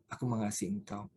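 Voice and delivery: usual speaking voice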